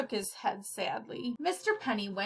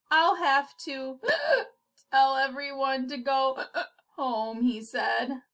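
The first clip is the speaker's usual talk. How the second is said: slow words broken by gasps; worried and hiccuping; anxious hiccup-broken speech; 3 semitones higher than usual. Acted.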